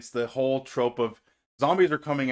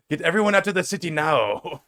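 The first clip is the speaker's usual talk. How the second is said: louder, higher mock villain order; Major Tom Kane; 5 semitones higher, 6 dB louder than usual. Co-host impersonating the bad guy, hammed-up urgent order. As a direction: give a hammed-up villain command. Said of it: "He plays Major Tom Kane".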